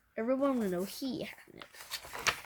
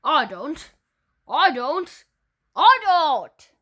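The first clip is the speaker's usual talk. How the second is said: each I don't louder and higher, ending in a shriek; a child insisting louder and louder; rising protest; 5 semitones higher, 14 dB louder, slower than usual. Acted. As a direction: build from a loud protest to a frantic shriek.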